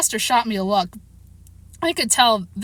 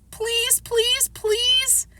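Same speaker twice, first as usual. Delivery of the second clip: very high, drawn-out pleading; begging cry; much slower than usual. A narrator's reading. Boy begging while squeezing the cat, high pleading. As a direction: squeeze out each plea in a tiny high voice. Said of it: "cried Toby".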